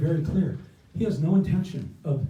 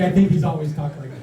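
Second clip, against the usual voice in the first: ordinary talk, nothing marked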